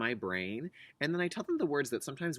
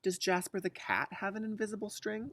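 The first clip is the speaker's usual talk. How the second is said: put-on higher, curious little girl; child voice; 8 semitones higher than usual. Liza asking mom about the cat, childlike voice. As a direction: ask it like a hopeful child.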